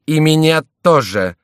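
high, loud, forceful; enraged, emphatic; furious. A narrator's reading. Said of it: "сказал Шомберг с яростью".

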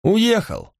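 high-pitched innkeeper's announcement; embarrassed innkeeper. A narrator's reading. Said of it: "Хозяин смущённо улыбался". (смущённый хозяин гостиницы)

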